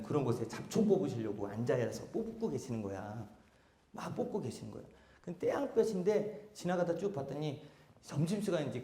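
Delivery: put-on quiet, hushed storytelling to kids; soft storytelling. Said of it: "박사님이 해주신 거예요".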